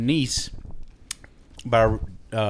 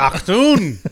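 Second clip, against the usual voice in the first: mock German exclamation; 6 semitones higher, 6 dB louder than usual.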